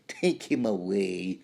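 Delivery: slow, haughty drawn-out order; mock-villain dismissal, words stretched; lightly voiced villain. Villain after the glove slap, slow haughty order. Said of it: "you would expect this villain".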